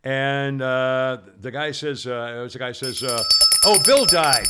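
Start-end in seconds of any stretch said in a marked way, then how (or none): 3.6-4.5 loud acted bad news; acted announcement. Husband breaking news in a TV ad, loud announcement. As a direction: blurt it out loudly.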